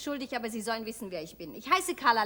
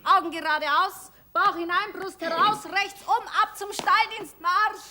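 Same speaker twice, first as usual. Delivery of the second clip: mock drill sergeant; 3 semitones higher than usual. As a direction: play sergeant shouting orders. Barking mock drill commands.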